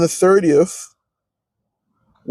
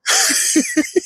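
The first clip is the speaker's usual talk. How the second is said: a sudden very high shriek of laughter. Real, not acted. Picturing dying at work, a very high shriek of laughter.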